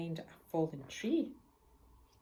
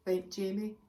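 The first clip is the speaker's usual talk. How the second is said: ordinary delivery, nothing marked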